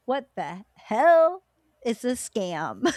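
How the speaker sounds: slow, drawn-out mock outrage; indignant, incredulous retelling; retold indignation. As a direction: stretch each word in exaggerated disbelief. Retelling her disappointed reaction to accents.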